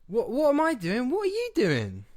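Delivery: high, indignant comeback; indignant Stan. Acted. Stan snaps back at Carrot, high and indignant. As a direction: throw the question right back.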